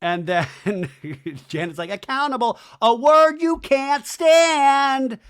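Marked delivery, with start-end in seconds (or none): 1.9-5.2 high, loud, stretched mocking holler; sing-songy taunt. Janet taunting Doty about accountability, sing-songy. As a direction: rise into a slow, jeering chant. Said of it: "does a sing-songy thing".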